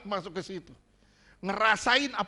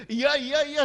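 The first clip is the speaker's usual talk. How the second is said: high, loud playful yes-yes-yes; mock eager agreement; 8 semitones higher, 8 dB louder than usual. Preacher mimics his wife answering his teasing, mock eager. Acts wife's quick agreement at joke.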